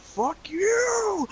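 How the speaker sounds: very high, exaggerated mock outburst; over-emotional demo. Shown as a demonstration. Showing how the brother didn't hang up, hammed-up high outburst. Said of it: "No sort of emotion, no sort of".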